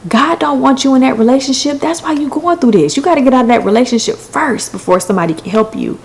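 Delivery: high, emphatic mock lecture; imitated preachy scolding. Trucker refusing to help, imitated preachy scolding.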